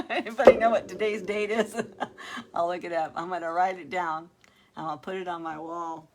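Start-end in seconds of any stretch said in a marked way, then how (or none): 0.1-2.5 loud, amused, laughing through the words; laughing question. Real, not acted. Streamer asking viewers the date, laughing as she talks. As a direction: ask it while cracking up.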